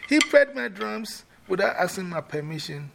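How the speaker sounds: high, loud aggrieved complaint. Shown as a demonstration. Drum owner rehearsing, loudly complaining to Queen Mother. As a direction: protest to the elder.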